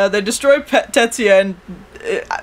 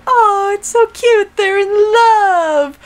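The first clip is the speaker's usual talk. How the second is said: high, slow, drawn-out swoon; mock gushing; 10 semitones higher, 3 dB louder, much slower than usual. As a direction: coo like an adoring crowd. Voices everyone gushing over the couple.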